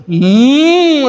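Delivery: swelling, stretched hum mimicking a first breath; imitated lung inflation. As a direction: imitate lungs suddenly filling with air. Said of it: "అని చెప్పి తీసుకుంటాయి".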